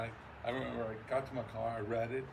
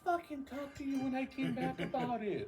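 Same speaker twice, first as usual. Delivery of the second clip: much higher, laughing protest; exasperated retort; 14 semitones higher than usual. Retold or imitated. Much higher laughing protest to his wife. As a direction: plead your case, exasperated. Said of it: "I'm like".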